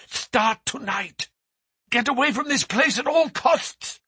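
high, insistent warning; urgent cry. A narrator's reading. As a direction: press the danger on him. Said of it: "she cried".

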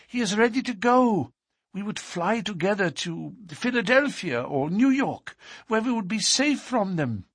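put-on hopeful young woman's voice; Etty. A narrator's reading. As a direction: urge him to flee with her. Etty lays out her plan.

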